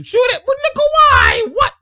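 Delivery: very high, loud cry; real hype outburst; excited shout. Real, not acted. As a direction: yell at the screen as the shot goes up. Urging shot, then disbelief.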